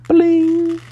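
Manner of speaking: high, whispery chime ding; a little high 'bling' for the page turn; imitated page-turn chime. Shown as a demonstration. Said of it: "chimes ring like this".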